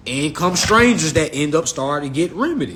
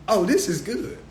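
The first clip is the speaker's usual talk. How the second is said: ordinary delivery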